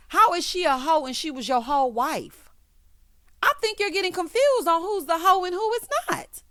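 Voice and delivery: high-pitched, incredulous telling-off; indignant challenge. Real, not acted. Host challenging husband over who's the hoe, high and indignant.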